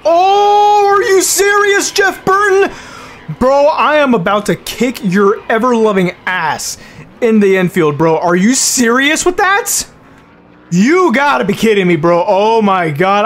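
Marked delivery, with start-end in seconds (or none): 0.0-2.7 shrieking high, loud disbelief; real furious outburst; outraged yell. Real, not acted. Dumped by rival in race. 3.4-8.2 angry threat. Real, not acted. Raging at rival driver. 8.2-12.2 real angry yelling; furious disbelief. Real, not acted. Raging at rival driver.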